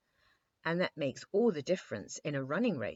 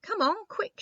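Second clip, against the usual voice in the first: much higher and louder call; eager cry; 9 dB louder than usual. A narrator's reading. Eyebright calls the king to race downhill, eager shout. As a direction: call him to hurry after her. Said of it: "she cried".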